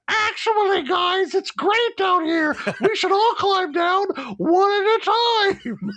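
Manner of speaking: high, loud, slow sugary lure; dragon faking a friendly voice. Pretend. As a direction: sweetly coax them down, high and loud. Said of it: "a suspiciously dragon-sounding voice from below going".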